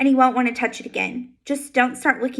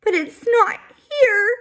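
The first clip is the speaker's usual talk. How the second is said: put-on high, slow, sobbing child; a scared boy crying for his lost toy; 9 semitones higher, 4 dB louder, slower than usual. A narrator's reading. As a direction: wail it out through tears. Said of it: "he finally cries".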